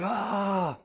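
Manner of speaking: high mock scream, held back in volume. Storyteller imitates the villain being chopped up, restrained high scream. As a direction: imitate a long high scream without full force. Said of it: "As he's screaming".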